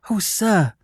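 high, lightly voiced plea; emotional cry. A narrator's reading. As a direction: cry it with a touch of quivering emotion. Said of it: "he cried".

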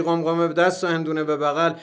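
ordinary delivery, nothing marked

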